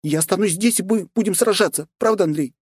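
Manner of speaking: put-on high, urgent young princess; young woman voice. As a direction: voice her as an alarmed young woman. Svetlana's voice. (встревоженная княжна)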